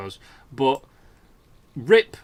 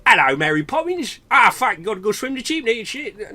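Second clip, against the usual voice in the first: mock Cockney-posh British accent, higher and louder; 8 semitones higher, 5 dB louder than usual. Mocking how American TV thinks Brits talk, hammed-up British accent. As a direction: ham up a fake British accent Americans expect. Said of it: "Nobody in Britain talks like that".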